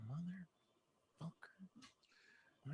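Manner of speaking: slow, breathless whispered swear; muttered Motherfucker in a whisper; whispered curse. Retold or imitated. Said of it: "I was like".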